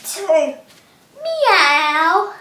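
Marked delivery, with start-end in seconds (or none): 1.2-2.3 loud meow rising at the end; cat meow. Shown as a demonstration. Teacher repeating the cat sit-up with a loud meow. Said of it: "cat sit-ups".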